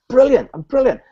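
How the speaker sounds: overeager brilliant. Retold or imitated. Speaker recalls answering the salesman's call, mock-bubbly. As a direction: play your past self, bubbly and eager. Own eager reply on phone.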